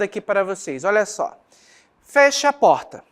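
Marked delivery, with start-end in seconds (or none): 2.0-3.0 "Feche a porta" dito como ordem firme, mais alto e agudo. Shown as a demonstration. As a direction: mande fechar a porta, firme. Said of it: "essa frase aqui expressa uma ordem". (ordem firme e lenta)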